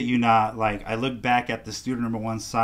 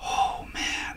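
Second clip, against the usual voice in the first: hushed, breath-only amazement; retold stunned murmur; awed whisper; 6 dB quieter than usual. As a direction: whisper it as it sinks in.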